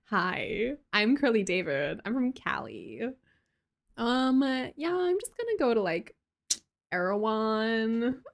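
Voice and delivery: mock California girl introducing herself; stretched-out Valley girl parody; Cali girl. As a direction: put on a Cali accent with swooping pitch. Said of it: "Do you talk like a Cali girl?".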